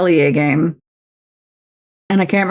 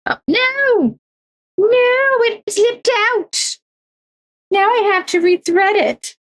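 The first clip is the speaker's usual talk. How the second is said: high, dismayed squeak as the thread slips; "No, it slipped out!" drawn out slowly and high; dismayed squeal; much slower than usual. Real, not acted. Crafter losing the thread from her needle, high dismayed squeak.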